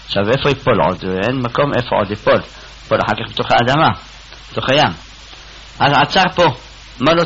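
normal talking voice

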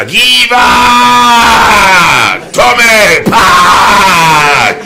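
long high drawn-out cry; hammed-up scream for help; acted screaming. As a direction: wail each word out, stretched and high. Acted scream of beaten man.

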